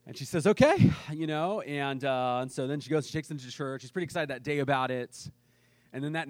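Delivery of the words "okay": high, loud, startled okay; a mother caught off guard by the gift; high and loud, surprised. Retold or imitated. Mom reacts to whole piggy bank.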